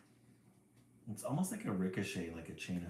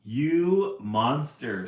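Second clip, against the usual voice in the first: loud, very slow scream; mother bird screams at the cat; 5 semitones higher, 13 dB louder, much slower than usual. A narrator's reading. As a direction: drag the insult out, furious. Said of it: "screams Mama J".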